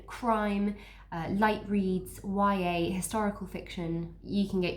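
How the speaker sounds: usual speaking voice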